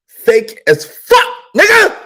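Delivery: slow, then high loud yell; shouting. Real, not acted. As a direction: bellow the insult. Yelling insult.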